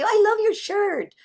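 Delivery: put-on high, gushing kid voice; complimenting kid. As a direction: pipe it up like an admiring child. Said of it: "how kids always say".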